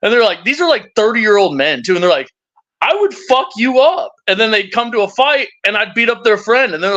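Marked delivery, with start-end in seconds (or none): 2.8-4.1 acted threat. Imitates grown men threatening him.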